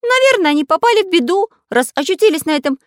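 very high, loud storybook character voice; high-pitched, emphatic little figure; voiced cartoon Pencil character, earnest. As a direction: pipe it up high and loud, speculating.

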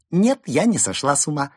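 high, loud giddy glee. Acted. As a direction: bubble over with glee. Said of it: "Берта хихикнула".

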